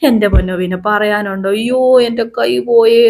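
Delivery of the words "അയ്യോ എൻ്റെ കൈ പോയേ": mock cry of pain. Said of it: "അവിടെ കിടന്ന് കാർച്ച".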